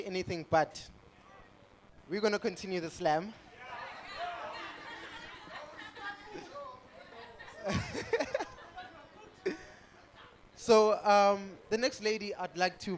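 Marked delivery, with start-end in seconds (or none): 3.4-9.7 a long, high amused laugh. Real, not acted. Host laughing at the stage, long high laugh. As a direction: laugh it off before carrying on.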